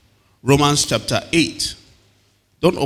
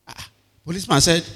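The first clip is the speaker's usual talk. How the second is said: ordinary delivery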